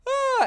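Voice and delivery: very high stretched fake yawn; loud mock yawn, way up high; imitated yawn. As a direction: yawn at the dog on purpose. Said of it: "just be like".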